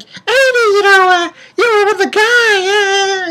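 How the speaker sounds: a put-on high voice, dragged out; high mocking friend voice. Friend pressing Amy about the guy, in a high mock voice. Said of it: "He's like".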